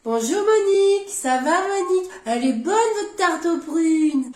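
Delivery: slow, drawn-out singsong greeting; exaggerated, sugary slow niceties; mocking politeness. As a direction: parody fawning small talk, stretching the words. Father parodies greeting mother-in-law.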